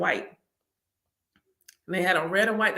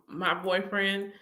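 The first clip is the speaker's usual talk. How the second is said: ordinary delivery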